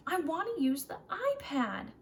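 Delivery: put-on upset child repeating his wish; sulky child. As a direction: insist like a cross kid.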